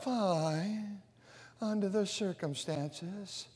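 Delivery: very soft, low, dragging whine; whiny reply. Retold or imitated. Mimicking a gloomy person, soft and whiny. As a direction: mope the answer out. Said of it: "you have to sort of whine".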